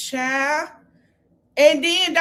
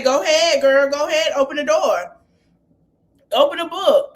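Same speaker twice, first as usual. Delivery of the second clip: loud, taunting go-ahead; mock egging on; 2 semitones higher, 5 dB louder than usual. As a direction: egg her on loudly. Cast egging the fight on.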